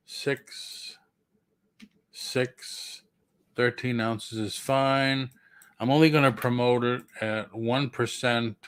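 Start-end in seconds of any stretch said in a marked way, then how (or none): 0.1-3.0 hoarse voice dropping to a whisper; raspy whisper. Real, not acted. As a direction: voice gone, raspy and unvoiced. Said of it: "losing my voice".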